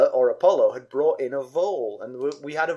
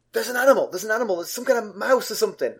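alarmed wife; 6 semitones higher, faster than usual. Retold or imitated. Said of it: "woke me up ... and said".

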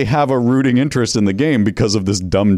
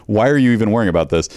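ordinary talk

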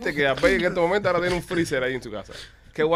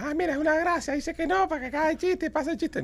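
high, mocking imitation of people; mock the crowd in a high voice; 14 semitones higher, 5 dB quieter than usual. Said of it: "la gente va".

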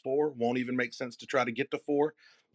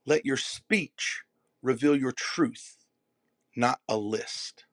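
slow, weighted; slower than usual. Shown as a demonstration. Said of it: "slow, deliberate delivery".

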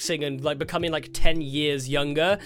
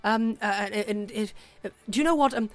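higher, hesitant well-wisher; Tasha; 5 semitones higher than usual. Acted. Contact promising to watch from above, high and hesitant. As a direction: fumble through a flustered good luck. Game master voices Tasha.

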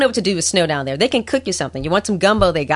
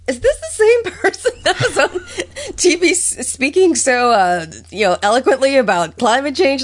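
higher-pitched, amused incredulity; mock disbelief; 7 semitones higher than usual. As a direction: imitate a baffled friend, laughing through it. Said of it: "you'd be like".

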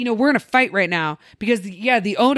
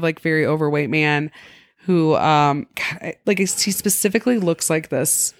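normal talking voice

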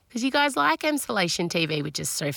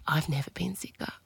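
hushed dramatic whisper; 6 dB quieter than usual. Real, not acted. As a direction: breathe it out, voiceless. Dramatic whisper about illness.

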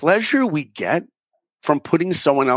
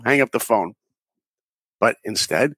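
normal talking voice

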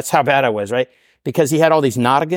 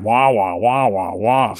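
lower, muted wah wah drone like the trombone teacher; Charlie Brown adult voice; 3 semitones lower, much slower than usual. Retold or imitated. Mocking the same old talk in AA, a droning wah wah. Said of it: "you know, Charlie Brown".

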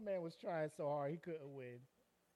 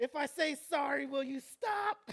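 high, raised begging, dragging out the end; hammed-up victim pleading for it to end; mock desperate bargaining; 8 semitones higher, 5 dB louder, slower than usual. Beaten man bargains with his attacker, mock desperate.

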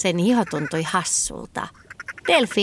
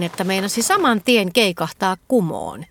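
plain reading voice

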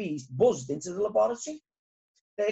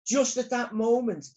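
usual speaking voice

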